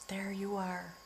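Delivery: ordinary talk, nothing marked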